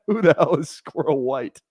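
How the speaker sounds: slow, laughing through the question; amused at the silly name, said slowly through laughter; laughing disbelief. Real, not acted.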